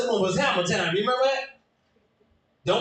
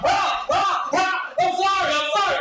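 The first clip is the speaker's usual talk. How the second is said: 1 pleading mock apology; 10 semitones higher than usual. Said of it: "You're like".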